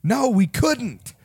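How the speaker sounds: high, drawn-out mock yell; retold yell. Someone yells back at the security guard, mock yell. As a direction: imitate someone yelling from a crowd, pitched high. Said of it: "somebody yells".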